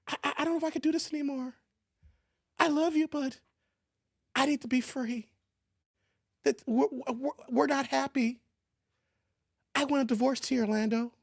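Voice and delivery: high, halting, softened wife voice; hesitant wife. Acted. Wife asking husband for a divorce, halting and soft. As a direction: stammer gently through the breakup.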